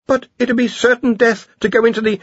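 high, anxious protest cut short; nervous Ponder. A narrator's reading. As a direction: break off nervously mid-sentence. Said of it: "swallowed, and restarted".

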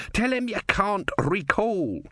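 high, urgent yell at no great volume; mock yell. A narrator's reading. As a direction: urge it in a high, held-back yell. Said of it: "Om yelled".

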